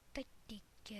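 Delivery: soft whispered tac tic; whispering. Real, not acted. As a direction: whisper the little clicks. Whispered sound effects while building.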